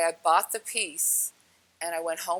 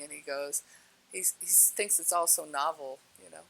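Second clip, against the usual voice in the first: usual speaking voice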